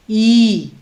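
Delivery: ई held long; drawn-out ee. Shown as a demonstration. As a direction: stretch the ई sound out long. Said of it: "लंबा खींचता है". (ई को लंबा खींचिए)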